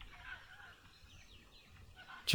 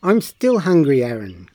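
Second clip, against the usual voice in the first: whiny call; 2 semitones higher, 6 dB louder than usual. A narrator's reading. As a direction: call out impatiently across the yard. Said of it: "called Charlie".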